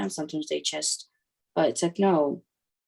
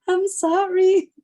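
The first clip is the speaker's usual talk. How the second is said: much higher, louder, drawn-out apology; exasperated apology; 7 dB louder, much slower than usual. Retold or imitated. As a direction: plead helplessly, high and slow. Helpless reply to son repeating himself.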